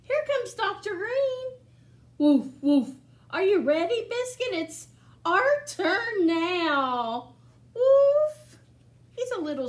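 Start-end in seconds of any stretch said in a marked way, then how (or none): 0.0-1.6 bright, lively picture-book reading; reading to children. Acted. Reads picture book aloud. 2.2-2.9 loud barked woof like a puppy; loud playful puppy woofs; dog bark. Acted. Dog barks in story. 3.3-7.3 bright, lively picture-book reading; reading to children. Acted. Reads picture book aloud. 7.7-8.6 loud barked woof like a puppy; loud playful puppy woofs; dog bark. Acted. Dog barks in story.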